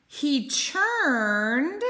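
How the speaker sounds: ordinary delivery, nothing marked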